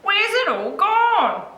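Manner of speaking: high, drawn-out cry of bafflement; storybook shout. A narrator's reading. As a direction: call it out high, stretching the words. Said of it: "he shouted".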